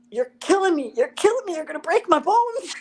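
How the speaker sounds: high, loud yelp of pain; playing the boyfriend crying out as his hand is crushed; mock pained cry. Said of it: "he's like".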